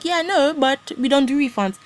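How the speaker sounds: high, loud mock refusal; high mocking shopkeeper. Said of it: "He was like".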